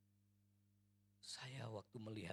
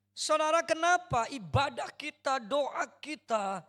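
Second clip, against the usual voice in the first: loud, higher, full-force sermon; fiery preaching; 17 dB louder, slower than usual. Acted. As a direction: rouse the congregation.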